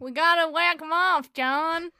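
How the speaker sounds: mobster voice. Pretend. Mafia cowboy bit.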